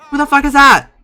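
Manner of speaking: high, loud startled cry; shocked outburst. Real, not acted. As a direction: blurt it out in disbelief. Startled by a figure in game.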